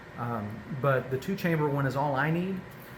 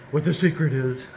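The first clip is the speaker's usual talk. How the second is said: lightly voiced silly dog character; Snoopy's voice; 5 dB louder than usual. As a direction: talk like your pet dog would. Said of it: "I'm speaking in my dog Snoopy's voice".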